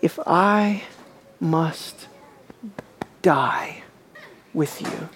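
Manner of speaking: Peter's hushed vow. Retold or imitated. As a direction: breathe it out like a solemn promise. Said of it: "Peter insists".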